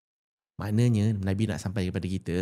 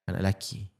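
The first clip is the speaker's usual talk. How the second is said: ordinary delivery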